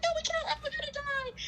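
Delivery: very high, squeaky wail; mock crying. Runner answering Dave at the drop-off, mock crying. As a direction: whimper it in exaggerated panic. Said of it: "I just started crying".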